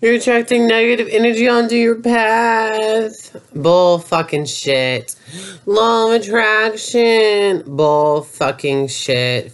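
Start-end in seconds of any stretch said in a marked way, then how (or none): 0.0-3.4 mocking spiritual believer. 5.6-7.6 mocking spiritual believer.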